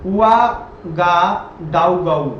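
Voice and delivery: the city name drawn out syllable by syllable; slow spelling-out. Shown as a demonstration. Teacher sounding out a strange capital name, very slow. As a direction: sound out उआगाडाउगाउ slowly, each syllable stretched. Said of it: "अब इसका नाम देखो".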